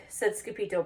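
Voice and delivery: usual speaking voice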